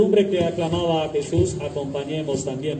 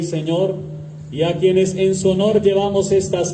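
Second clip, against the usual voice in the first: solemn measured prayer voice; liturgical prayer; 2 semitones lower than usual. Acted. Priest leads opening prayer.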